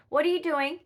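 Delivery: high and loud, catching the kitten out; sudden startled call to the pet; surprised pet talk. Real, not acted. Owner catching the kitten climbing, surprised.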